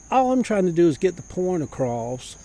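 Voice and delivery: normal talking voice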